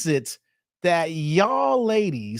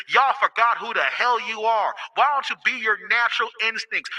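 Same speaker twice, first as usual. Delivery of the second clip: ordinary talk, nothing marked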